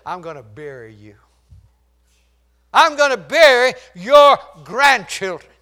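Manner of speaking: Khrushchev's threat. Retold or imitated. A statement he made.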